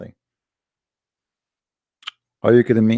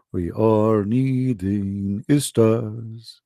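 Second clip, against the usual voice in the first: very slow mocking drawl; much slower than usual. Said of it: "do we have any Ustaz".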